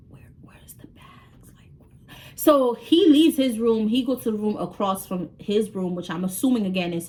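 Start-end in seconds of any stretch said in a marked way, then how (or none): 0.1-2.2 slow, barely audible whisper; under-the-breath whisper, drawn out; whispered question. Retold or imitated. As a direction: whisper it slowly to yourself. Muttering to self while waiting.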